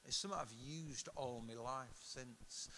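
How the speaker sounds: ordinary delivery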